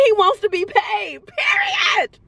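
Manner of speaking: high-pitched, crying out the last word; emphatic hype. Real, not acted. Reader backing Dobby's wage demand, emphatic hype. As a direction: declare it like the final word. Hyped defence of Dobby's pay.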